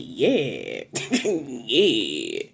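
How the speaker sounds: "Yeah" pushed out with a steep drop to a low pitch; mock your own "Yeah", dropping it deep; mimicking own odd yeah. Replaying own odd "Yeah" to herself, exaggerated falling tone. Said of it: "Why did I say yeah like that".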